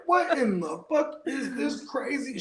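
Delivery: high, slow, laughing "what in the fuck"; laughing disbelief. Retold or imitated. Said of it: "I was just like".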